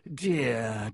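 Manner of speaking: sneering fake sympathy; nasty mock pity. A narrator's reading. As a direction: pretend to pity him, nastily. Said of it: "smiling still more nastily".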